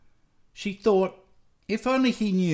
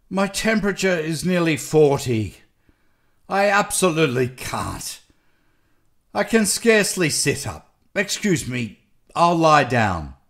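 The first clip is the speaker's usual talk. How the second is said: louder, feverish doctor begging off; exhausted, sick doctor; 3 semitones higher, 6 dB louder than usual. A narrator's reading. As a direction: plead illness emphatically as a worn-out doctor.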